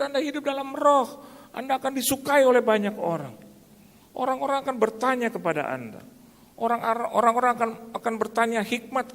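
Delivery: high, insistent pulpit voice; impassioned preaching. Real, not acted. Preacher urging congregation to live in spirit, high fervent preaching. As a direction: preach with fervour, pitch held high throughout.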